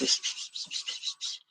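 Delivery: soft unvoiced psh bursts like radio static; imitated static hiss. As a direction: hiss out the hack box noise. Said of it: "all I could hear is psh".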